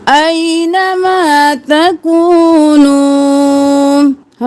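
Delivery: slow melodic tajweed chant; Quran recitation. Shown as a demonstration. Recites verse continuing. (قرآن کی تلاوت، آہستہ اور سریلی)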